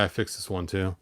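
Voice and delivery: ordinary talk, nothing marked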